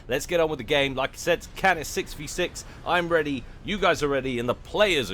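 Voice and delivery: hyped up. Acted. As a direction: pump up the crowd. Caster hyping the match start.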